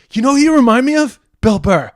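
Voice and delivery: high, loud and fast; excited blurt. Retold or imitated. Restaurant owner telling the comedian who he resembles, excited.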